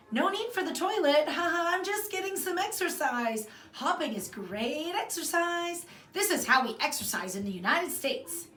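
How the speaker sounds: forced cheerful excuse. Acted. Girl covering with forced cheer.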